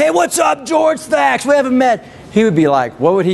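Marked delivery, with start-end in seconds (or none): 0.0-2.0 hammed-up high-five hello shouted across the room; high-fiving shout. Shown as a demonstration. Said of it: "If I went".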